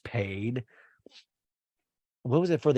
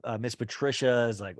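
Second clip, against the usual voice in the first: normal talking voice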